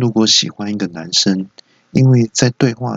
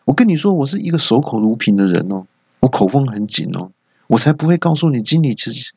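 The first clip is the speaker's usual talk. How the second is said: mild indignation; 6 semitones higher than usual. Acted. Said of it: "我就很生气啊".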